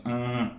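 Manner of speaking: "an" held as one long nasal vowel. Shown as a demonstration. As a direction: sustain "an" through the nose. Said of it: "eu só escuto isso".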